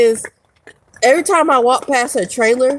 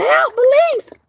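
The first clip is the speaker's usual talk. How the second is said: high, squealing mock plea for help; playing the frightened victim, squeaky high; mock cry for help; much slower than usual. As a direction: shriek it like a fake victim, pitched way up. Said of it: "I should've started yelling".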